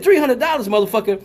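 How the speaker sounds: put-on high, loud outburst without accent; mock outrage, African accent suddenly gone; accent dropped. Customer shocked by the braiding price, accent dropped. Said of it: "the whole accent go away".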